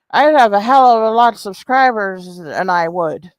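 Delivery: put-on voice parroting a muddled sentence; mocking imitation of rival. Streamer repeats Susan's muddled claim, mocking imitation. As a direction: mimic her garbled grammar in a mock voice. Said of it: "talking all slurring and shit".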